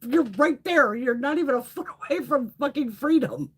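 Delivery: urging the bird to the open door, high and pleading; exasperated urging. Retold or imitated. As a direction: coax the bird, nearly laughing in disbelief.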